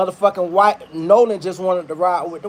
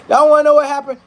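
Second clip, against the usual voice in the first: louder, higher hook to the crowd; calling out; 7 semitones higher, 5 dB louder, faster than usual. Real, not acted. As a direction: grab the crowd's attention. Calling out to audience.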